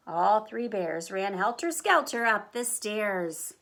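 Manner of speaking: usual speaking voice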